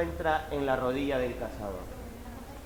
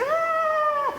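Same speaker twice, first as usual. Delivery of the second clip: very high squeaky scream of fright; a tiny ant shrieking at the hand; little ant screams as the giant hand comes; 3 dB louder than usual. Acted. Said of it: "y dice".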